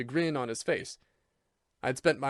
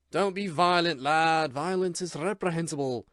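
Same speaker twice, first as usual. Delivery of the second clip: lightly voiced soothing admonition; calm him with mock sweetness; sweetly patient Irishman; 4 semitones higher, 4 dB louder than usual. A narrator's reading. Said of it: "with maddening sweetness and patience".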